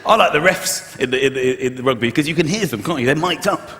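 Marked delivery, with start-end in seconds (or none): none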